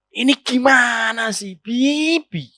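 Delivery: whiny, drawn-out wifely complaint. Shown as a demonstration. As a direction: stretch the words out in exasperation. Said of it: "Coba kalau dirubah".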